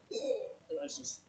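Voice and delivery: breathless, near voiceless; winded whisper; choked. Retold or imitated. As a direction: squeeze it out with a hurt throat. Hit in the throat, can't breathe.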